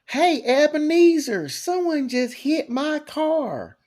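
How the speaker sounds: high, slow, overblown ad caller; car wreck ad voice. Retold or imitated. Mocking a bad car wreck commercial, high and slow. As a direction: ham up a whiny accident victim in an ad. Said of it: "the commercials are awful".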